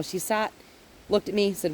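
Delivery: ordinary delivery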